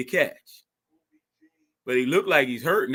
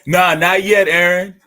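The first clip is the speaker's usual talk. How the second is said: loud; 3 semitones higher, 10 dB louder than usual. Real, not acted. Telling friend not yet celebrate.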